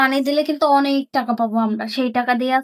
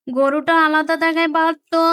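usual speaking voice

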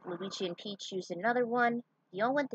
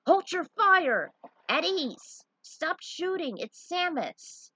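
commanding call; 9 semitones higher, 6 dB louder, slower than usual. Acted. Commander calls ceasefire.

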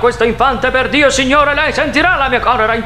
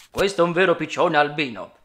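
ordinary delivery

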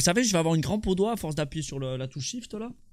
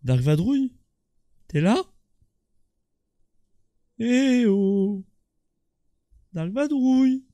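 calling out; 6 semitones higher, 5 dB louder, slower than usual. Real, not acted. Calling out for lost teammate.

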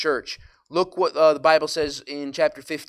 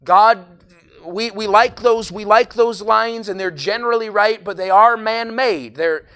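fervent preaching; 4 semitones higher, 9 dB louder than usual. Acted. Preaching at full force.

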